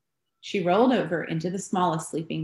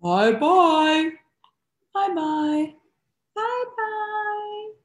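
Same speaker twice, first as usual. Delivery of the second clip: three bears calling; 12 semitones higher, much slower than usual. A narrator's reading. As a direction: call goodbye as three bears. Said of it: "gruff voice, middle-sized voice, high squeaky voice".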